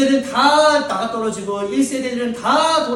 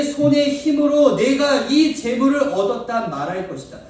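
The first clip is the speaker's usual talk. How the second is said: scripture reading; 4 semitones higher, 5 dB louder than usual. Acted. Reads scripture.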